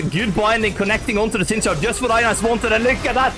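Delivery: high, urgent esports casting; excited play-by-play. Real, not acted. Caster calling the three-man stun, excited play-by-play. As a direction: call the big play with rising excitement.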